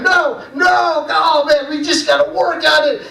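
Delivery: high, shouted no no with a laugh; cheerful frustration; exasperated teacher. Retold or imitated. Teacher hyped but correcting kids. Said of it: "choir teacher".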